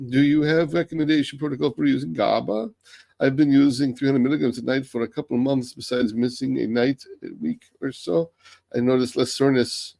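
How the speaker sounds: comments read aloud in a lightly faked German accent. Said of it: "I started doing a German accent".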